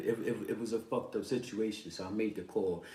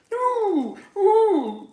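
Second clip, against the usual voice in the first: high, loud imitated dog whimpering; dog whine; 16 dB louder than usual. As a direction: whine like a dog shut outside. Imitating the dog's crying.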